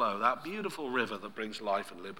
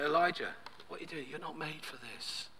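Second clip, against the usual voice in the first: name first, then drop to a hush; gentle, very soft reproof; still small voice; 3 semitones higher, 6 dB quieter than usual. Acted. God reasons with runaway Elijah, softly. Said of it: "The still small voice".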